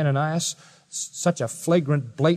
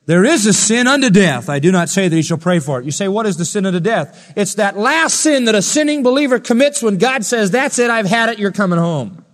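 loud, raised pulpit proclamation; forceful preaching; 7 semitones higher, 7 dB louder than usual. Real, not acted. Preacher explaining the sin unto death, forceful preaching. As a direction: drive the warning home with a raised voice.

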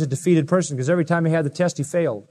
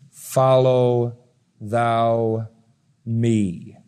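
slow, separate words with weight on thou; emphatic thou; 4 semitones lower, much slower than usual. Shown as a demonstration. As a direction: lean on thou, each word apart. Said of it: "Emphatic use of the pronoun".

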